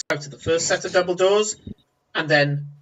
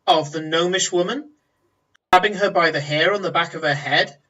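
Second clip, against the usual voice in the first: ordinary talk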